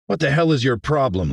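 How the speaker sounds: high, heated confrontation; exasperated challenge. Acted. Mayor confronts the complaining teacher, exasperated. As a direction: challenge her, fed up.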